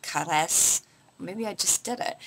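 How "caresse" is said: attempted French pronunciation of Caresse; French accent. Shown as a demonstration. Vlogger tries a lipstick's French name, French accent. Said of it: "say this with a French accent".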